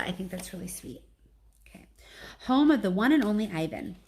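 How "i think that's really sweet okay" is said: soft whisper, almost no voice; whispered aside. Real, not acted. Reader comments to listeners on the sweet name, whispering. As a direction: breathe it as a tender aside. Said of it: "unvoiced whispered aside".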